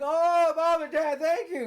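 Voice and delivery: high, loud cry of relief; hammed-up high, grateful outburst; acted relief. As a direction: play a kid overjoyed to be rescued. Glenn greets parents with relief.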